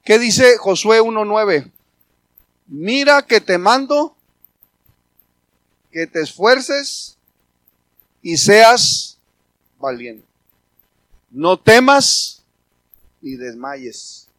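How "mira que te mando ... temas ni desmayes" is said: slow, loud and raised; preaching the verse. Acted. Pastor proclaiming Joshua's command to the church, slow loud. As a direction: proclaim each phrase with force.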